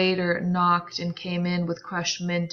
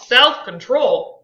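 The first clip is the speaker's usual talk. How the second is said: louder, higher, slow disbelief; incredulous; 5 semitones higher, 10 dB louder, slower than usual. Acted. Tom repeats Daisy's word, slow loud disbelief. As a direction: repeat it in scorn. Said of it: "repeated Tom incredulously".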